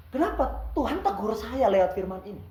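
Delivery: ordinary talk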